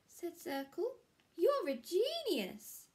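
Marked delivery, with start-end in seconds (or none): 1.3-2.9 high, louder, slow admiring voice; Circle's voice. Acted. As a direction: gush a slow compliment up high. Circle admires Square's work.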